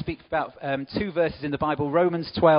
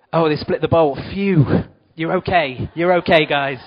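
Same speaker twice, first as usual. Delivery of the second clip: sudden loud shout of alarm, then relief; startled cry; 3 semitones higher, 10 dB louder than usual. Real, not acted. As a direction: call out as the bowl spills, then reassure. Bowl of sweets spills.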